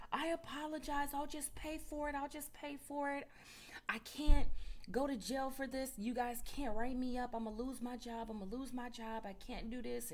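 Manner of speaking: high, pleading apology, barely crying; mock hysterical pleading. Nurse caught on camera begs staff, mock hysterical. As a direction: imitate a panicked nurse begging. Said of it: "like, hysterical, crying".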